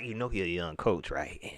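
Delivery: lightly voiced conniving reporter egging him on; mocking the reporter. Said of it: "He's like".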